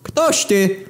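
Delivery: high hail to a shadowy figure; calling out. Acted. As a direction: shout it across the cave. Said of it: "wykrzykując przy okazji w jego kierunku".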